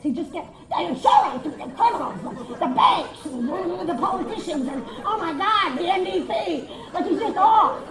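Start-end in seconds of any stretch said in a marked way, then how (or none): 0.7-6.7 loud, sputtering list of grievances; mock unhinged rant; hammed-up ranting outburst. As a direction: blurt each one like an uncontrolled eruption. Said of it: "then he gets Tourette's".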